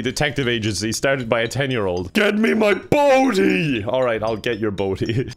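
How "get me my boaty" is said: drawn-out, high, louder mock tantrum; demanding yell. Player acts out a childish detective, demanding yell. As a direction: yell it like a kid demanding a toy.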